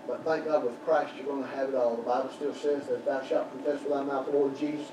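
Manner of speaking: normal talking voice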